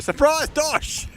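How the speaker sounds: very high, slow, cracking shout; very high giddy squeal; hysterical yell. Real, not acted. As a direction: squeal it while losing it. Caster cracks up at ambush.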